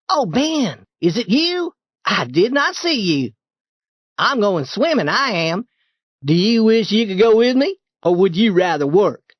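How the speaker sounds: high, breezy mock surprise; carefree boy Tom. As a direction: act like you never noticed him.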